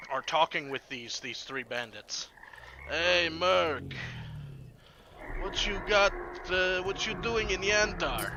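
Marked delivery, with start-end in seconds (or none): 2.9-8.3 rough greeting from a bandit, pitched up; bandit hailing someone. Acted. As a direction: call out cockily as a bandit. Game master voices bandit hailing merc.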